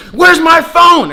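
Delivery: very high, loud, stretched-out imitation; mimicked yelled demand. Girl hunting any reason not to leave, mock shouting. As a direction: yell it slowly as an outraged girl.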